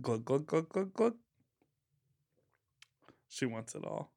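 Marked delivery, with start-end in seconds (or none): 0.0-1.2 mock glugging. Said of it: "imitating drinking".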